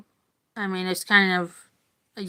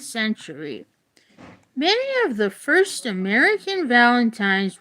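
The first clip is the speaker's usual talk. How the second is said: clear, measured book-reading voice; reading aloud; 4 semitones higher, 4 dB louder than usual. Acted. Reading a Valentine's history book to viewers, in an even read-aloud voice. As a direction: read it out like a class textbook.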